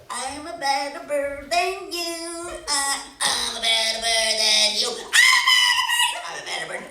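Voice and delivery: many birds shouting each other down; squabbling birds. Acted. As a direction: pile the boasts on top of each other. All birds boasting and squabbling.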